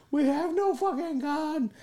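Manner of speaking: very high, drawn-out mock shriek without much volume; imitated high scream. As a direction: mimic your own embarrassing, stretched-out screaming. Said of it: "screaming like I'm a fucking bitch".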